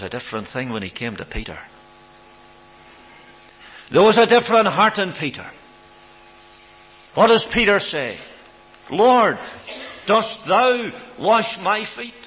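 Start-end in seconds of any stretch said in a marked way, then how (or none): 3.9-8.2 loud, high preacher's cry; preaching at full force. Acted. Preacher to the congregation, loud and ringing. 8.9-12.1 slow, loud, high cry of disbelief; astonished protest. Acted. Peter to his Lord, slow and astonished.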